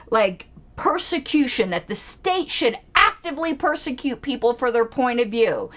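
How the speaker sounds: ordinary delivery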